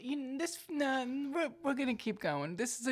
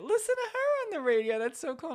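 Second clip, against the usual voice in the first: thrilled, high-pitched and louder; gushing, pitched way up; excited; 8 semitones higher, 5 dB louder than usual. Retold or imitated. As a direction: squeal with delight for a friend on the radio. Relives her delight at hearing friend.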